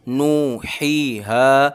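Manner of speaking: very slow नू ही हा, every vowel drawn out; slow chanted recitation; all stretched. Shown as a demonstration. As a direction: hold नू, ही and हा long. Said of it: "नून हा दोनों खींचेंगे और हा भी".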